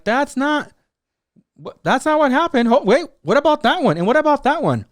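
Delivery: high-pitched disbelief; incredulous protest. Retold or imitated. Viewer objecting to the uncut footage, incredulous.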